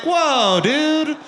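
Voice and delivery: high, loud, startled reaction; alarmed protest. Acted. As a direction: cut in as if shocked. Startled bystander reacting to creep.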